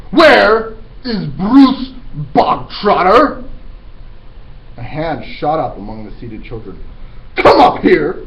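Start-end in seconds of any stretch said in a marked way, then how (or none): 0.1-3.4 loud high slow demand; bellowing headmistress. Acted. Trunchbull demanding the boy step forward, bellowing. 7.3-8.2 loud high command; shouted order. Acted. Headmistress shouting at the boy to come up. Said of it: "the Trunchbull shouted".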